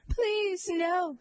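high-pitched desperate plea; crying out. A narrator's reading. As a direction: cry out for mercy, pitch leaping up without shouting. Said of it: "she cried".

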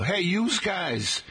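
slow, high New York yous guys, like a mob guy; New York gangster yous guys. Shown as a demonstration. Host shows listeners the New York mob greeting, in a New York accent. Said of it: "How do you address a group".

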